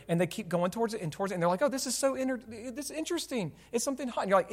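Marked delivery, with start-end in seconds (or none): 1.6-4.2 put-on high, softly wondering little-kid voice; curious child. Preacher imitating a child drawn to the hot stove, curious kid voice. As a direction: lightly play a fascinated kid, pitched up and quiet.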